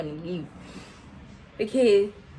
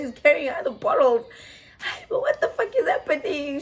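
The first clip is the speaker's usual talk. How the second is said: ordinary talk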